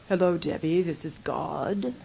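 very low, hushed, booming-God parody; slow down into a deep, soft divine voice; mock voice of God. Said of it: "it's not a voice like".

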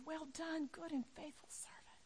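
soft whispered blessing; tender whisper. Pretend. The Lord to his servant, soft tender whisper. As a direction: breathe it like a gift. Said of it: "longing to hear those words".

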